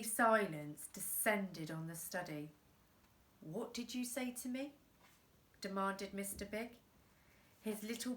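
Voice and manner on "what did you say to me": quiet, much softer threat; menacing hush. Acted. Boss menacing the boy.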